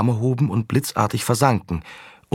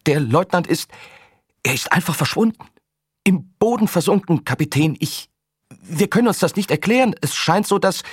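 panicked; 10 semitones higher, 3 dB louder than usual. Acted. Panicked soldier reporting by radio.

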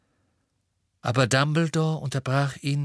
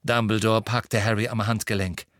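ordinary delivery

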